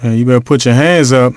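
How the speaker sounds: normal talking voice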